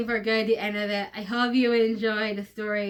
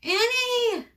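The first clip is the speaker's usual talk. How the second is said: scream; 12 semitones higher than usual. Acted. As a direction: scream her name out. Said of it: "and he screams".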